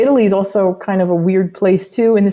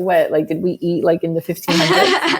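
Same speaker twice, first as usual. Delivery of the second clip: mock bafflement; 3 semitones lower than usual. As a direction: play the joking kid teasing the old tense, laughing. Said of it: "we joke around, like".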